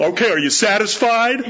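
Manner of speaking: high, laughing sarcastic retort; mock defiant kid. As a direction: sneer it at the parent. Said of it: "they look at you".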